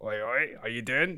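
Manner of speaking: a light Cockney accent, barely put on; a quick Cockney hail over the intercom; little Cockney accent. Peter Sellers as the alien calling over the tannoy, light Cockney accent. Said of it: "with a little Cockney accent".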